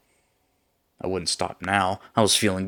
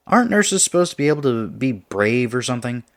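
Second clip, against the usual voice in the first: higher, louder challenge; challenging jab; 6 semitones higher, 9 dB louder than usual. Acted. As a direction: throw it out as a loud taunt. Taunting friend in an acted quarrel.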